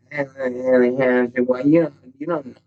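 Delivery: mocking, hesitant put-on voice; mock naysayer. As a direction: imitate a doubter dragging out objections. Mimics an unsupportive, doubting person.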